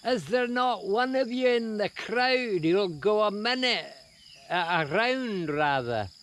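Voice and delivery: Scottish MC announcing into the mic; MC calling to the crowd. Pretend. MC challenging the Glasgow crowd, in a Scottish accent. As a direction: rouse the crowd like a ring announcer. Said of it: "the M C picked up the mic".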